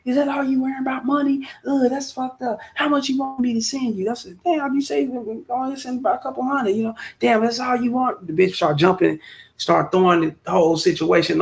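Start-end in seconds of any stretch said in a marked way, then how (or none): 0.0-8.3 put-on high, hurt girlfriend complaining; mocking girlfriend voice. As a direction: whine like she's offended about money.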